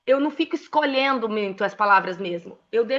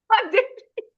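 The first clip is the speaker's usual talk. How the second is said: laughing; 10 semitones higher than usual. Real, not acted. Laughing while repeating herself.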